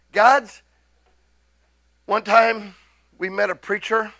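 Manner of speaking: usual speaking voice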